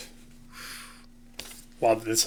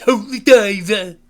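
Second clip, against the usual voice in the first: much higher, louder, slow hammed-up song title; 9 semitones higher, 10 dB louder, slower than usual. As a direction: belt it out like a goofy rock showman.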